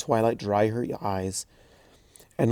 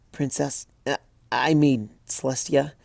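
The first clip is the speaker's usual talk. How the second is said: put-on higher, hesitant young pony voice; a lightly raised, flustered student; flustered young mare; 4 semitones higher than usual. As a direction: stumble over the title, embarrassed. Twilight's voice, flustered.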